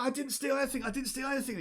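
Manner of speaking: high-pitched, quick denial; accused kid squealing a denial; protesting child. Acted. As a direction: plead innocence in a high little voice. Accused kobold child protests innocence.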